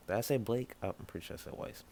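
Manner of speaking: usual speaking voice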